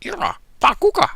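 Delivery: loud squeaky Stitch cry. Acted. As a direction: shriek it like a little alien. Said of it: "unmistakable voice cries out".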